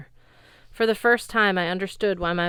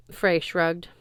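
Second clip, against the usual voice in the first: usual speaking voice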